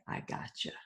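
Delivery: breathless whispered gotcha; stepfather's taunt. Retold or imitated. Imitating the abusive stepfather mocking her tears, whispered. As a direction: hiss it out as a cruel whisper.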